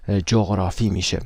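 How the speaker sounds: usual speaking voice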